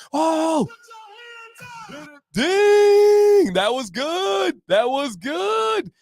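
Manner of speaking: high, slow shouted praise; excited shout. Real, not acted. Streamer hyping a viewer's rap, shouting slowly.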